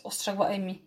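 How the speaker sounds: usual speaking voice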